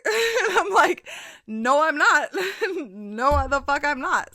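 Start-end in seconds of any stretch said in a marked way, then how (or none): 1.5-4.3 laughing loudly through a high-pitched no; laughing refusal. Retold or imitated. Laughing as she refuses to be a drunk stranger's good luck charm. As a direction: crack up while turning him down.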